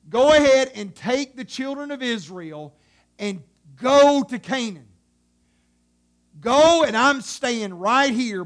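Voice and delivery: loud, emphatic divine threat; angry God. Acted. God telling Moses to go on without him, angry. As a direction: preach it as God's angry decree.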